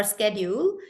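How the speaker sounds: American "schedule" as "SKED-yool"; American pronunciation. Shown as a demonstration. Teacher offering another American variant, "schedule" with sk. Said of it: "Americans say education and schedule".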